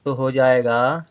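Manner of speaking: normal talking voice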